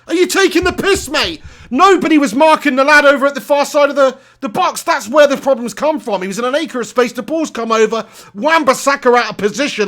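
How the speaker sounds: high, agitated complaint; exasperated rant. Real, not acted. Fan commentator blaming the defence for the goal, exasperated rant.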